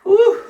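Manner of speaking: high, loud exhaled "whew"; winded whew. Real, not acted. Host resting from a long round of plunging, winded whew. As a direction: blow out a high whew after hard effort. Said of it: "I'm getting a little out of breath".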